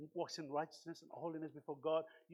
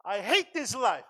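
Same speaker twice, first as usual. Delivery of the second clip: shouted at full force, hating his life; loud cry of despair; 8 semitones higher, 14 dB louder, slower than usual. Retold or imitated. Pastor retelling his drunk self crying out to God. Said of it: "I said loudly".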